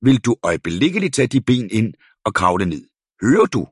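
loud, high, scolding from a window; angry neighbour yells at child to climb down; scolding shout. A narrator's reading. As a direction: order her in at full volume. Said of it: "råbte hun".

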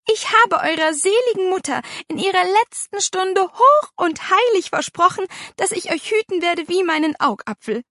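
high, loud emotional protest; impassioned vow. A narrator's reading. Aunt reminding the children of her deathbed promise, impassioned. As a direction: declare it with wounded solemnity.

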